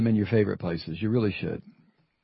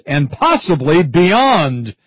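slow, louder ominous stress on beyond; ominous emphasis; 4 semitones higher, 8 dB louder, much slower than usual. Real, not acted. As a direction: drag out and possibly beyond. Mocking the Pentagon phrase.

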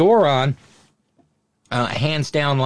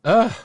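disgusted ugh; 9 semitones higher, 5 dB louder than usual. Real, not acted. Disgust at the mess.

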